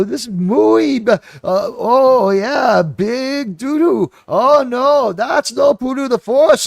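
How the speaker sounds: high, loud, hammed-up goofy creature; exaggerated high-pitched dimwit babble with drawn-out cries; Jar Jar Binks. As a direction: wail it like a clueless cartoon alien. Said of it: "they act like Tilly from Star Trek Discovery or like Jar Jar Binks".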